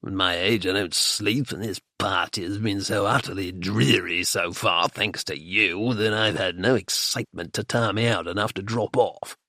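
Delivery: put-on low, grumbling old man's voice; querulous old rogue; Nuggs, bored old man. A narrator's reading. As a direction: wheedle and complain like a restless grandfather.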